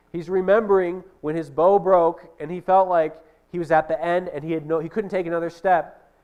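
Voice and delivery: ordinary talk, nothing marked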